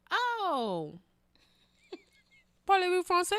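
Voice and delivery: high, affected French; showing off French. Retold or imitated. Telling friends how she greeted the foreigner, showing off French. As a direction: put on a high, fancy French line.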